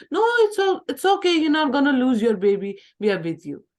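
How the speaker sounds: high, loud, eager reassurance. Shown as a demonstration. Support worker reassuring loudly.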